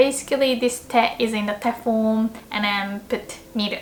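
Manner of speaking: ordinary talk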